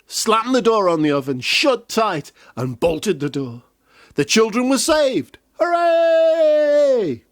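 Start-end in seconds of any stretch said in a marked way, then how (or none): 5.6-7.2 cheering. A narrator's reading. Children saved, cheering.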